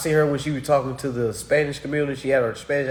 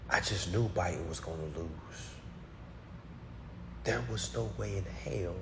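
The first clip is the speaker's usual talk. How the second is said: hushed, much softer voice; stunned disbelief; 11 dB quieter than usual. Real, not acted. Streamer recalling Biden's unexpected win, hushed and stunned.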